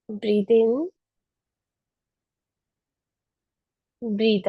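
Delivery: calm, unhurried meditation voice; guided meditation. Acted. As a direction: guide them gently through each breath. Leads seated pranayama. (ध्यान कराने वाली शांत आवाज़)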